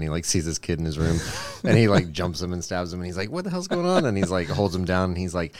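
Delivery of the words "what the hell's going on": high-pitched alarmed victim; startled mob guy. Acted. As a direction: jump up in pitch, caught off guard.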